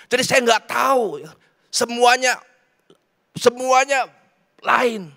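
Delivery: impassioned preaching. Real, not acted. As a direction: preach it high and urgent to a crowd. Preaches at full force. (khotbah berapi-api dengan nada tinggi)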